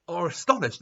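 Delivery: ordinary delivery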